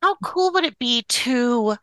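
ordinary delivery

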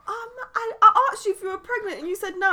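high-pitched imitation of a protesting student; confused pupil. Student confronts teacher who denied pregnancy, high puzzled protest.

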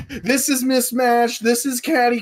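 high, drawn-out list of grievances; mock ranting complaint. Acts out guildmate's ranting annotations.

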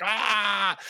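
high, hammed-up dying yelp; dramatic death cry. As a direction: ham up a fake death. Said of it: "die more dramatically".

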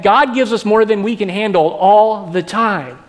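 ordinary talk, nothing marked

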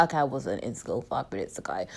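low, soft climb-down; deflated admission. Acted. Correcting her own exaggeration to listeners, low deflated aside. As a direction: drop right down and quietly concede.

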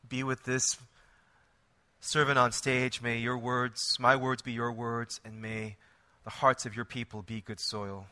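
soft, hushed pastor's prayer. Acted. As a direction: pray quietly and earnestly. Pastor prays before sermon.

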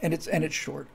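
ordinary talk, nothing marked

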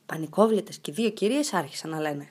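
usual speaking voice

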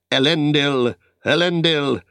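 loud, high rallying cry; battle cry. A narrator's reading. Aragorn charges through the trees with a battle cry. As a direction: shout the name as you charge. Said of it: "Drawing his bright sword and crying".

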